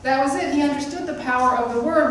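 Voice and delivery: ordinary talk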